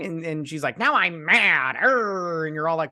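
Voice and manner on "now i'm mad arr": hammed-up angry growl, higher and slow; mock mad. Imitating the princess mad about everything, hammed-up anger. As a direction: stretch out a mock tantrum. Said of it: "she was mad about everything".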